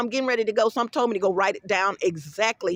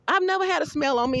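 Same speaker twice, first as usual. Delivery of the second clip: usual speaking voice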